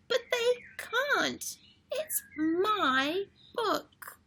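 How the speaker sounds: put-on high wailing little girl, near tears; whiny upset Lola. Lola protesting to Charlie at the library, near tears. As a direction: wail that it's hers. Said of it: "Lola says".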